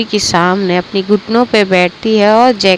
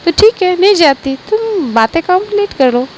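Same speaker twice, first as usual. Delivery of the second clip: lightly voiced mother, pitched higher; easygoing mother, a bit higher; a hint of a higher-pitched mom; mother's voice; 9 semitones higher than usual. Mother answers daughter in story.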